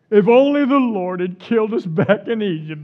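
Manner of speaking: hammed-up groaning complaint; moaning. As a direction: Israelites moaning to Moses, mock moan. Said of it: "they moaned".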